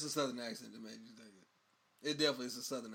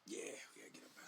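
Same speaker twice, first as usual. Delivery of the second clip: hushed whisper, fast; 14 dB quieter, much faster than usual. Real, not acted. Co-host whispering to the host that they must leave now, hushed. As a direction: breathe it out quickly, no voice.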